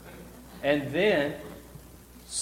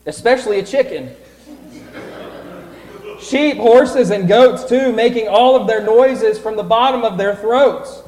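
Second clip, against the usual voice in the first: loud, high, eager telling; excited narration; 6 semitones higher, 8 dB louder than usual. A narrator's reading. Storyteller building up excitement for the animal noises. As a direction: build up the noisy stable. Lively narration of noisy stable.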